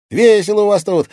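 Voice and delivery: put-on high, loud, cheeky boy; bright ringing teenage boy's call; ringing boyish voice. A narrator's reading. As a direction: sing out like a lad making an entrance. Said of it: "произнёс звонкий мальчишеский голос".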